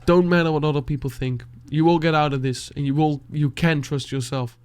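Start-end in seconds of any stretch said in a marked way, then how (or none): none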